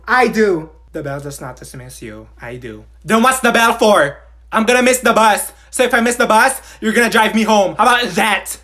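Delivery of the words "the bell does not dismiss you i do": low, quiet mocking echo under the breath; muttered mockingly. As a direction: mutter it back so the teacher can't hear.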